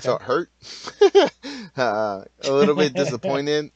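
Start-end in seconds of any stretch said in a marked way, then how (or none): 0.6-1.7 loud high-pitched laugh. Real, not acted. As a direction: burst out laughing. Laughs at own hurt feelings.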